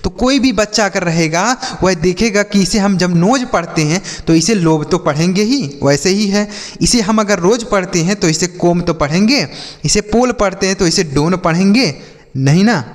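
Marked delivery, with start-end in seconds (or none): 4.6-4.9 लोब with a long "oh" vowel; misread with long O. Shown as a demonstration. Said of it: "गलत तरीके से पढ़ेंगे". 8.5-8.8 कोम with a long "oh" vowel; misread with long O. Shown as a demonstration. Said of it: "गलत तरीके से पढ़ेंगे". 11.1-11.4 डोन with a long "oh" vowel; misread with long O. Shown as a demonstration. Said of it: "गलत तरीके से पढ़ेंगे".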